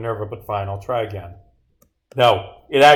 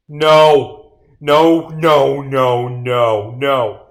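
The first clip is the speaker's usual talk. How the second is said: loud, drawn-out cries of no; cornered and refusing, belt out each no; desperate denial; 9 dB louder, much slower than usual. Acted. Killer exposed by the detectives, desperate loud denial.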